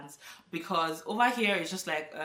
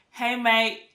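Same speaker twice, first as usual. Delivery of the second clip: higher, louder British greeting; imitated English accent, pitched up and loud; 6 semitones higher, 9 dB louder than usual. Shown as a demonstration. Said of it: "see if I can still form accent".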